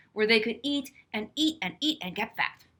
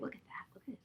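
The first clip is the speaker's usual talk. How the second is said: whisper; 18 dB quieter, much faster than usual. Real, not acted. Aside about a picture.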